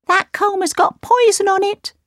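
a startled little dwarf; second dwarf crying out. A narrator's reading. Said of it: "cried the second dwarf".

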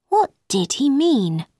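ordinary delivery

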